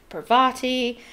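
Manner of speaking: "Parvati" as par-VAH-tee; broad middle vowel. Shown as a demonstration. Reader lists versions heard, "Parvati" as par-VAH-tee. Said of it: "how to pronounce her name".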